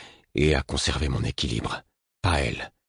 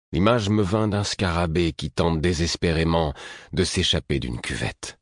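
ordinary delivery, nothing marked

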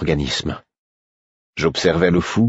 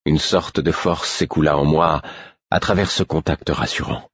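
ordinary delivery, nothing marked

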